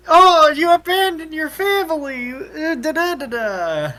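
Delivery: high mock accusation falling into da-da-da; mock scolding. Imitating April berating someone about family, high mocking complaint. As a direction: imitate a nag, starting high and loud.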